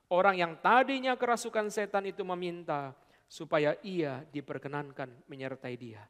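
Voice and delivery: ordinary delivery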